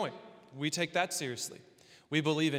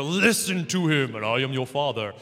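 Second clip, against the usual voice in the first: God; 2 semitones lower, 6 dB louder than usual. Acted. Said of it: "That's my God impression".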